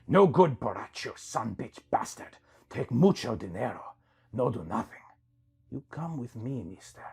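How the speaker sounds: lightly voiced sly lizard hustler, broken English; a hint of a wheedling lizard guide. Lizard man badmouths the broker.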